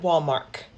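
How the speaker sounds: "Walmart" ending in a hard K; "Walmart" said as Wal-mark; grandma's pronunciation. Retold or imitated. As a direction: swap the T of "Walmart" for a K. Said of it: "gave it a K instead of a T".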